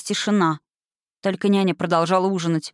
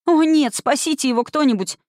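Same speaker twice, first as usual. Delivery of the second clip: high, pleading outcry; distressed cry; 7 semitones higher than usual. A narrator's reading. Dorothy calls for someone to save Toto, distressed. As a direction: cry out for help, pitched high. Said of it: "закричала Дороти".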